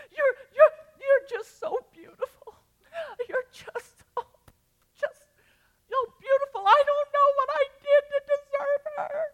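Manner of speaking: high, loud, tearful cry of praise; crying yell. Retold or imitated. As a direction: sob it out loud like an overwhelmed groom. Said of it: "he just yells through all of his tears".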